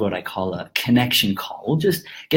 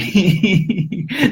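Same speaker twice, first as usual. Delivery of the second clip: laughing; 7 semitones higher than usual. Real, not acted. Laughs at own explanation.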